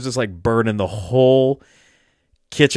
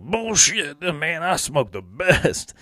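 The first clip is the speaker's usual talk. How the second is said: higher put-on uncle voice, slowing right down on the boast; imitating the uncle; 5 semitones higher, much slower than usual. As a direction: stretch out the stubborn brag like the stoned uncle. Uncle insists his weed is best.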